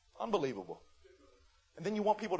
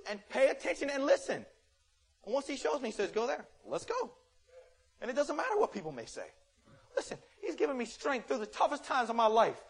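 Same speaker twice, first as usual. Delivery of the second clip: rising sermon delivery; 6 semitones higher, 6 dB louder than usual. Acted. Pastor preaching on God's guidance.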